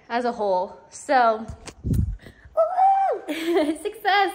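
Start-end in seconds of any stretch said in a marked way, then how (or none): 2.5-4.3 high laughing woo-hoo; celebrate, squealing with laughter; excited cheer. Real, not acted. Cheering the finished corner.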